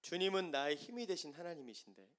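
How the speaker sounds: usual speaking voice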